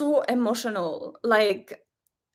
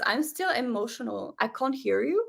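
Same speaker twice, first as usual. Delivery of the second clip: ordinary delivery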